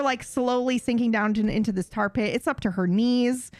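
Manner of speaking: ordinary delivery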